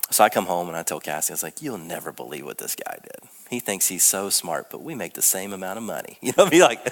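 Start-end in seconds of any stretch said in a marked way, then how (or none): 1.6-6.2 low, soft grumbling to the wife; lower, softer griping about the colleague; grumbling complaint. Retold or imitated. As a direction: complain under the breath, sulky.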